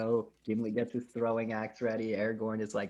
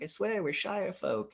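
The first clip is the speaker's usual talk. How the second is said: higher, slow pleading hobbit voice; 8 semitones higher than usual. Retold or imitated. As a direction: beg him slowly to believe you. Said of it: "And he's like".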